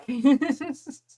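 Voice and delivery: high, loud laugh at oneself; self-amused laugh. Real, not acted. Streamer laughing at own topic-jumping, high loud laugh. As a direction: burst out laughing at your own remark.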